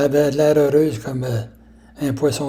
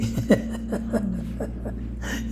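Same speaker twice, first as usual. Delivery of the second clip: soft, airy real laugh; whispered chuckle at the memory; breathy laugh; 6 semitones higher, 9 dB quieter than usual. Real, not acted. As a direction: let out a quiet amused laugh. Teller laughing at own story.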